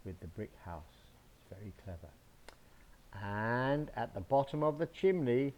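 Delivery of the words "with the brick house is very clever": ordinary delivery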